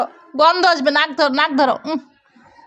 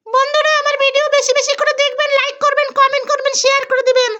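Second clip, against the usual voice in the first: put-on childlike voice; 7 semitones higher than usual. Mother voices child confession.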